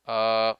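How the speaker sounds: "uh" like a speaker pausing; low, relaxed, drawn-out "uh" of hesitation; hesitation schwa. Shown as a demonstration. Teacher showing the pause sound speakers make, a relaxed hesitant "uh". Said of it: "when they pause and say".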